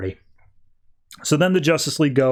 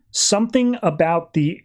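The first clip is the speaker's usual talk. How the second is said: normal talking voice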